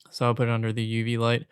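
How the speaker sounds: ordinary talk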